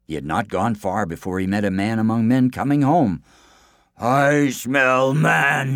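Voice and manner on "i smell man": loud, very slow giant roar; raised, drawn-out man-eater's cry; bellowing giant. Acted. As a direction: bellow it, stretching out each word. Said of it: "he bellowed".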